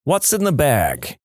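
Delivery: put-on slow Jersey-accented question; narrator's take on a Jersey accent; heavy Jersey accent. A narrator's reading. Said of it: "in a really heavy Jersey accent".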